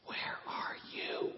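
urgent hushed whisper, slow. Retold or imitated. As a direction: whisper it so nobody in church hears. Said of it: "So she's whispering".

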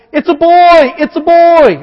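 high, loud happy shout; excited announcing. Retold or imitated. New father on the phone, excited shout. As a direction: blurt out the news with joy. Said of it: "I found myself in the past going".

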